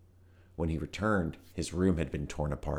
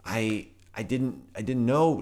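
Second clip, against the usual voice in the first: slow halting stammer rising higher; 6 semitones higher, 4 dB louder than usual. A narrator's reading. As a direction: stumble over the words in panic. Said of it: "stammered Volt".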